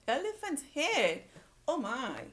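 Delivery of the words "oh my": usual speaking voice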